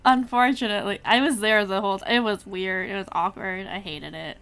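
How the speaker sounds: loud, emphatic complaint; exasperated outburst. Acted. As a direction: blurt out the awkward memory loudly. Emphatic complaint about witnessing the romance.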